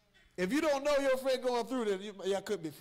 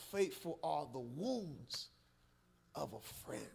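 slow solemn hush; 6 semitones lower, 9 dB quieter, much slower than usual. Real, not acted. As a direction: quote the verse low, soft and slow. Solemn slow verse, much softer.

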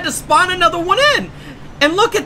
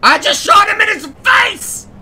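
excited shout; 7 semitones higher than usual. Real, not acted. Gamer cheering after shooting Bugs, excited shout. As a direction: crow about the lucky shot. Celebrating a hit in game.

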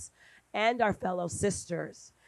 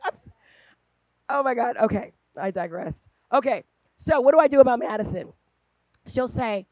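normal talking voice

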